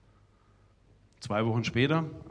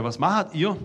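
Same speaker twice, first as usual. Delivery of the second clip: shouted across the room; 5 semitones higher, 6 dB louder, slower than usual. Retold or imitated. Guest calling over to the players in the living room, shouting. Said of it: "dann schrei ich ins Wohnzimmer rüber".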